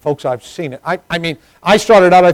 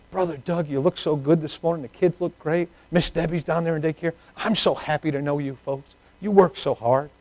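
encouraging church lady; 3 semitones higher, 5 dB quieter than usual. Retold or imitated. Church lady praising a young couple, softly encouraging. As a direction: gently gush compliments in a lighter voice.

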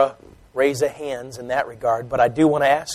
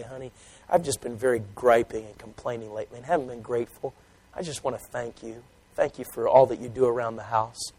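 soft thanks; 4 semitones lower, 11 dB quieter than usual. Acted. Husband thanks his wife.

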